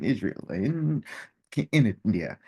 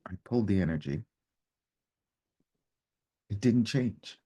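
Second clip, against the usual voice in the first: ordinary talk, nothing marked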